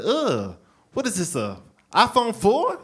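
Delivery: high, scornful question; mock disgust. Girl in the club seeing his old phone, disgusted. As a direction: recoil with a loud ugh, then pitch up.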